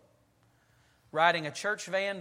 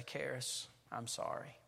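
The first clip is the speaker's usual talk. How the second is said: whispered, much softer apology; son's apology; 5 semitones lower, 11 dB quieter, slower than usual. Retold or imitated. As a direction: barely breathe the sorry out. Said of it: "he'll say".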